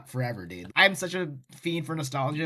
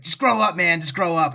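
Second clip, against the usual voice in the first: retold exasperated yell; 3 semitones higher, 7 dB louder than usual. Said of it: "and he's like".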